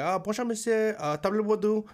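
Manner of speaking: careful tourist French greeting; rehearsed French, a little proud; mock careful French accent; practiced French. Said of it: "I'm practicing the whole way".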